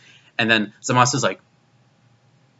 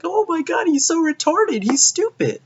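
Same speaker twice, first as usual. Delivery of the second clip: much higher, squeaky mockery; high mocking caricature voice; mocking dumb voice. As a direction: sneer at a dumbed-down hero in a silly high voice. Mocking how Goku is written.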